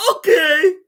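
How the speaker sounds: high, loud squeal; mock eager compliance. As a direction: chirp it like a gullible follower. Mocks a blindly obedient follower.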